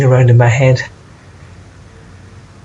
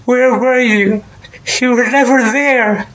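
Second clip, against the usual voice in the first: high-pitched, anguished crying reproach; tearful wail at someone who abandoned you; 10 semitones higher, slower than usual. Acted. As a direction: cry it out, very high, dragging out the words. Anguished reproach of absent mother.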